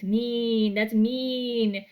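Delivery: "mean" with a long ee through bared teeth; teeth-baring "mean". Shown as a demonstration. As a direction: stretch the ee of "mean", showing your teeth. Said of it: "You show your teeth".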